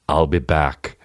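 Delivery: put-on low lightly done Austrian Terminator accent; a hint of Arnold's Austrian drawl; Schwarzenegger accent. Mocking Terminator line, light Austrian accent. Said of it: "the first time they did it".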